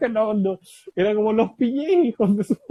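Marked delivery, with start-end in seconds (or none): 1.3-2.6 much higher and louder, laughing through it; triumphant celebration. Retold or imitated. Winner gloating at the crowd, high, loud and laughing. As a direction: crow over the win.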